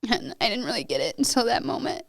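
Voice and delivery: real tearful voice after a long pause; crying. Real, not acted. Wife recalling when the death sank in, crying. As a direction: struggle to get it out through tears. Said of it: "I'm gonna cry with you".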